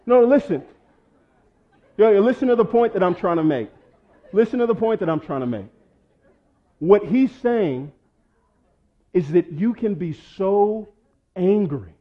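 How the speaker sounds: high, loud, emphatic preaching; preaching at full force. Acted. Pastor presses the church to listen, preaching loud and high. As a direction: drive the point home to the congregation.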